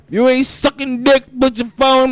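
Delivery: loud, high aggressive yell; mock aggressive rapper shout; aggressive imitation. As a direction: bark it at the crowd. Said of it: "He said it aggressive as a bitch".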